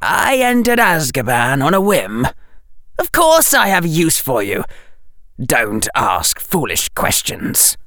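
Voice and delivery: high, imperious villain voice, dropping at the end; hissing Dark Lord. Acted. Harry rebuking Bellatrix as the Dark Lord, imperious. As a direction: scold coldly as a sibilant dark lord. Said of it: "hissed Harry's voice without him even thinking".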